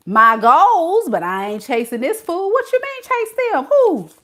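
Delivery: high, loud, incredulous comeback; hammed-up indignation, climbing to a high squeal; mock indignant. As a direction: protest in disbelief, pitch shooting up. Acts indignant at being told to chase.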